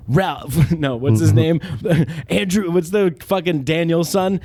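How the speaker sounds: usual speaking voice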